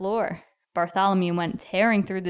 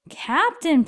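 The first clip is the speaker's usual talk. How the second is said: pleading; 7 semitones higher than usual. Acted. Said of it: "pleaded Bartholomew".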